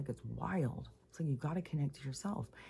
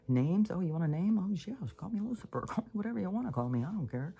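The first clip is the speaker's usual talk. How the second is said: higher, fast, taunting spirit voice that laughs it off; mocking dark spirit; 5 semitones higher, faster than usual. Voicing the dark energies taunting seekers, high and fast mocking. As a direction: toss the name out like a smug trickster.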